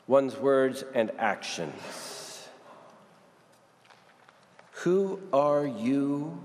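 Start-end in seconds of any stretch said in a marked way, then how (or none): 4.8-6.4 slow searching question. Shown as a demonstration. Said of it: "Define yourself".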